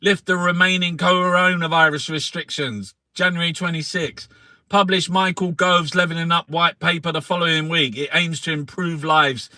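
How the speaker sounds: loud, punchy reading of headlines; newspaper read aloud. Acted. Reading the Sunday paper out to friends, loud and mocking. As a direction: declaim the headlines to the room.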